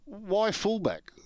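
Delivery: high, slow, drawn-out "Why fullback?"; incredulous protest. Retold or imitated. Player questioning the coach's choice, slow incredulous. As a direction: stretch out the disbelief.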